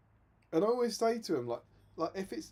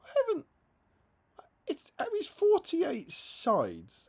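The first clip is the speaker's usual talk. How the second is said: exasperated retort; 12 semitones higher, 5 dB louder, slower than usual. Retold or imitated. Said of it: "I was like".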